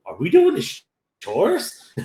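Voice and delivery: high, rising disbelieving question; mock incredulous. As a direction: imitate a baffled worker, voice climbing high. Retells characters' disbelief at chores.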